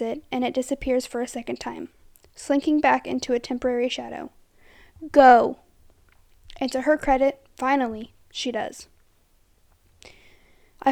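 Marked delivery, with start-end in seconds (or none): 5.1-5.6 a sudden, much louder shout; loud urgent shout to get her moving; shouted order. Acted. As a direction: yell at her to run, now. Urging the girl to flee.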